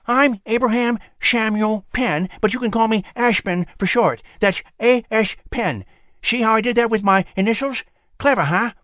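high, lisping, pleased with himself; whistling squirrel voice. Acted. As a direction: squirrel with buck teeth, boasting. Squirrel voice, proud.

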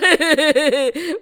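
loud, drawn-out villainous chuckle; gloating cackle. A narrator's reading. Trishanku cackles while reaching towards heaven, gloating cackle. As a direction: cackle with greedy glee.

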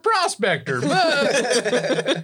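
put-on high, slow old prospector voice; gruff mountain man. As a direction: play a cackling old trapper. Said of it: "I'm just an old trapper prospector".

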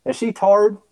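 mock-questioning "tired" in one syllable; teasing dialect imitation. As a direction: tease with a country "tired", like "tarred". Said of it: "instead of tired, it was tard".

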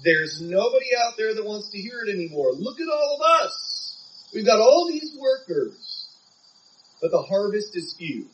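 high-pitched, mildly hammed-up complaining; mock complaint. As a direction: imitate people griping, voice lifted high. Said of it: "talk like this".

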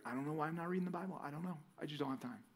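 mumbled excuse. Retold or imitated. Preacher playing a lazy believer excusing himself, fast soft mumble. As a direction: mumble the excuse to your leader. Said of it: "I'm gonna be like".

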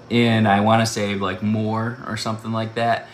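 normal talking voice

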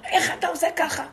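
high, loud, half-laughing rebuke; mock scolding judge. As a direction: scold him from above, mocking. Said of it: "ואומר".

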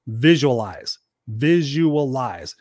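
"Visualize" said twice, the second drawn right out; emphatic repetition. Real, not acted. Coach giving listeners a key tip, emphatic "Visualize". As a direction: stretch "Visualize" slowly on the repeat for weight.

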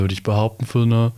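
usual speaking voice

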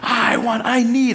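high-pitched mock toddler demand; mad little son. Dad imitates his son demanding a cookie, mad toddler whine. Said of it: "And he was mad".

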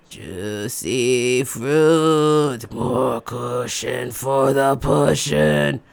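low, very slow sleazy drawl; creepy old man. Retold or imitated. Creepy driver behind her, low slow leering. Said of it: "he goes".

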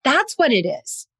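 a lightly retold aha, high on Target; light eureka moment; realizing the real cause, mildly excited; sudden realization. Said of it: "And I was like".

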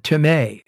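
"timē" ending in a stressed MAY; pronunciation shown. Shown as a demonstration. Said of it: "is the way you say that".

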